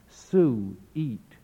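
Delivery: "su-eat" drawn out in two separate syllables; extended sweet. Retold or imitated. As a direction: linger on "su-eat", pulling the vowel apart. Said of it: "extends sweet again by diphthongizing the vowel".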